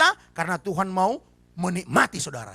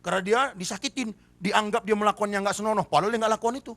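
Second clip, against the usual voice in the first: ordinary talk, nothing marked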